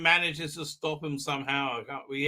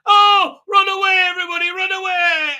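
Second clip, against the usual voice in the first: high, loud panicked shriek of run away; hammed-up terror, fleeing; mock panic; 11 dB louder than usual. Doctor fleeing the pirates, loud high mock panic. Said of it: "Doctor is shitting herself".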